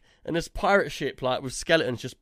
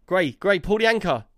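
raised, louder urgent order; urgent repeated call; 4 semitones higher, 5 dB louder than usual. Retold or imitated. Skipper to crewmate as the ship nears the wall, urgent. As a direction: call out more insistently to a crewmate.

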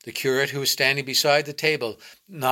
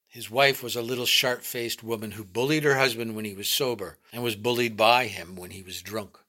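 plain reading voice

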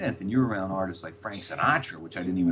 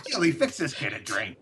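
higher-pitched imitation of an impatient order; mock exasperated order; 4 semitones higher than usual. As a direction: overrule the kid, hammed-up. [name] repeats the order, overruling wine.

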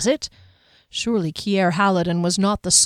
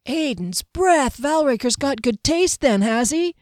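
mocking young guard; 6 semitones higher than usual. A narrator's reading. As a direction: savour the scandal with a sneer.

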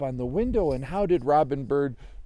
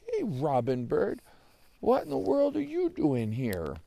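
puzzled greeting; 8 semitones higher than usual. Acted. Joey greets the bird at his window, puzzled. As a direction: pitch leaping high in surprise.

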